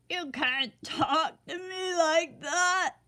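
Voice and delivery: a hint of sobbing protest; sobbing king. A narrator's reading. The king protesting to Sunshine, mild sob. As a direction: sniffle out a hurt protest. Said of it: "sobbed the king".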